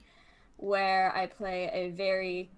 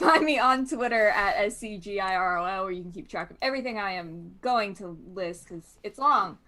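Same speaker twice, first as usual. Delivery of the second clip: normal talking voice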